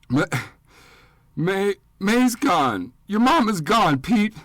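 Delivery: a grieving husband chokes out the news in tears; sobbing. Acted. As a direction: cry it out, barely able to breathe. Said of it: "crying so hard he struggled to breathe and speak".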